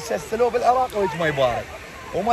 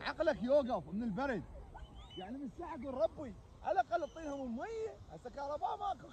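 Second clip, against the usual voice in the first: muffled underwater; 5 semitones higher, 14 dB quieter than usual. Real, not acted. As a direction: voice heard through water.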